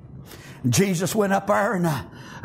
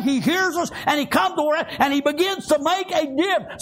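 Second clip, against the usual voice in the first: fervent preaching; 7 semitones higher than usual. Real, not acted. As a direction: preach it with conviction to the congregation. Preaching with force.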